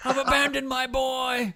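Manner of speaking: high strained mock wail; hammed-up high wail of confession; mock confession cry. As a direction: imitate a desperate, high-pitched confession. Imitating the film's shouted confession.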